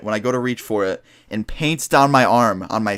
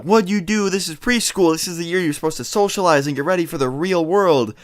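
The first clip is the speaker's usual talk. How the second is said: imitating scolding mom; 6 semitones higher than usual. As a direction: scold like an exasperated mother, pitched up. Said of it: "She comes in yelling at me".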